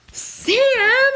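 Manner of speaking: high loud call of a name; cry out. A narrator's reading. Nina crying out to Sam, high and loud. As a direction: call his name in alarm. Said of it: "cried Nina".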